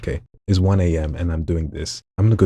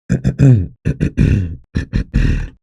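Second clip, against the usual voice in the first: louder rough growl from low in the throat; deep throat-clearing growl; 3 semitones higher, 6 dB louder than usual. Shown as a demonstration. Showing viewers where the demon growl sits. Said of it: "move it down deeper into your throat".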